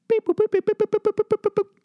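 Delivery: very high electronic beep boop; mock crosswalk beep. Comic imitating the crosswalk button beeping, very high. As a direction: chirp it like a crosswalk signal.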